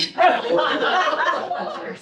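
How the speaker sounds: real burst of laughter at her own story; laughing. Real, not acted. Presenter laughing at the leader's joke, full laugh.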